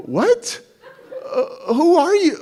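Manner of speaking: high confused what; baffled, voice jumping up; retold shock, pitched high; stunned. Stunned at the crowd.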